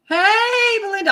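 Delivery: high, bright excited hello to a viewer; excited greeting. Real, not acted. Painter greeting Belinda in chat, high and excited.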